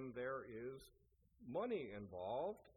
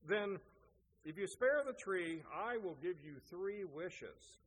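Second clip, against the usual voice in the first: higher, louder storytelling fairy, lightly played; lightly voiced forest fairy; 5 semitones higher, 5 dB louder than usual. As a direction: make the bargain as a hint of a fairy. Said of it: "the forest fairy said".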